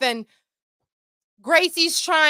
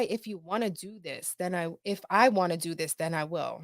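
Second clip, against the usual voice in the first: puzzled quiet repeat; 7 semitones lower, 8 dB quieter than usual. Retold or imitated. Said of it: "that sounds weird".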